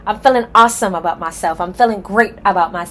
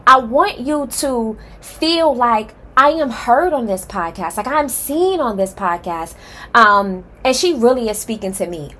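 ordinary talk, nothing marked

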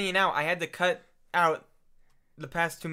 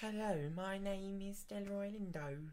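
put-on slow, soft British accent; 2 semitones higher, 13 dB quieter, slower than usual. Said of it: "I go like".

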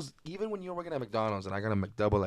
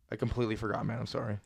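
ordinary delivery

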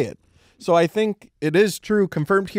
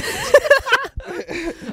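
high cackle. Real, not acted. Triumphant return after being "banned.

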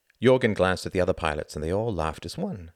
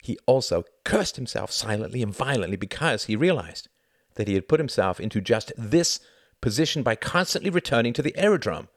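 plain reading voice

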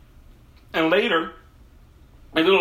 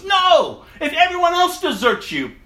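high, eager boast; Peter's bold promise; 13 semitones higher, 5 dB louder than usual. Retold or imitated. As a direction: swear loyalty a bit too brightly. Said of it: "He tells Jesus".

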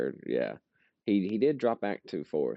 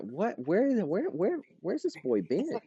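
high, stunned disbelief; incredulous; 10 semitones higher than usual. Retold or imitated. Telling friends about a worn-out driver, high incredulous disbelief. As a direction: gasp it out like you can't believe your eyes. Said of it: "And I'm like".

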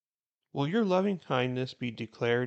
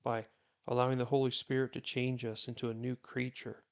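softer, hushed prayer voice; quiet prayer; 5 dB quieter than usual. Real, not acted. Pastor prays before the Bible reading, softly and earnestly. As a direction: pray gently and earnestly to the Lord.

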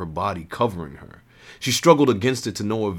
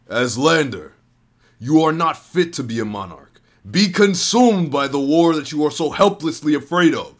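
higher, louder furious scream; villain cursing Eslander, screaming louder and higher; 7 semitones higher, 7 dB louder than usual. A narrator's reading. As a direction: scream the curse at him. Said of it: "he screamed".

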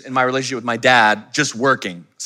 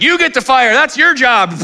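high, loud dad voice; hammed-up dad, high and loud; dad's brush-off; 9 dB louder than usual. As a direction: toss the job back as dad. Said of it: "And he's like".

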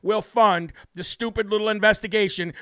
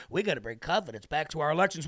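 lower, softer, fast parroting; mock the senator's empty line; parodying the senator, low, quick and snide; mocking politician voice; 5 semitones lower, 6 dB quieter, faster than usual.

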